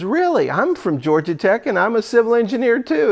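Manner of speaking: "Really?" jumps high, eager and bright; mock delighted alumnus; excited surprise. Alumnus surprised to meet a fellow Georgia Tech grad, excited.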